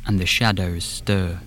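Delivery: slow, hushed spooky storyteller; hushed suspenseful narration. A narrator's reading. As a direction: draw the listener into the dark woods. Podcast opening scene setting.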